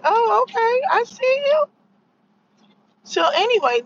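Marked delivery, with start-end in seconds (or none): 0.0-1.6 high, knowing, sarcastic sing-song; sarcastic high oh okay. Retold or imitated. To Stephen A, high sarcastic I see you. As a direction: say it like you caught him out. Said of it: "I was like".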